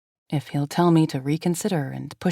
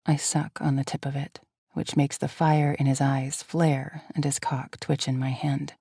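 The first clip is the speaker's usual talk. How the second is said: ordinary delivery, nothing marked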